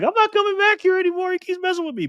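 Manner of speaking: high falsetto whiny complaint; mock Michelle. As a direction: squeak it out like an annoyed woman. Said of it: "Michelle's like".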